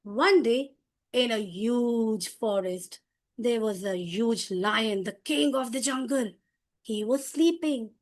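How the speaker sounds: slow, drawn-out storyteller narration; dramatic slow story voice; modulated storytelling. Shown as a demonstration. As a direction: tell it to small children, lingering on key words. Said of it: "But now".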